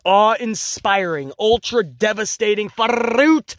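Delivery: oversell it like a ring announcer; mock hype. Vlogger sarcastically hyping a cheap roll-up, slow and booming.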